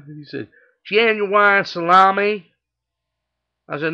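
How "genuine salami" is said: slow, loud, high Southern repeat; southern accent. Acted. Customer repeats his order to the clerk, loud southern accent. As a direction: drawl it louder in a Southern accent. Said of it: "he's got a southern accent".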